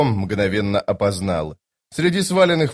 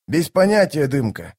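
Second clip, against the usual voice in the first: plain reading voice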